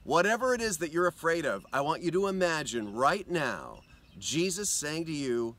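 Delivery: ordinary delivery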